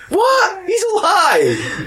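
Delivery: very high squeal of mock surprise; hammy presidential impression leaping up high; Bill Clinton. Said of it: "It's former President Bill Clinton".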